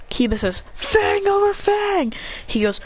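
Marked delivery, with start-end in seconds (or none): 0.7-2.1 high, drawn-out battle call; attack cry. Acted. Kiba launching his attack, calling it out. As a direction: call the move out high and long.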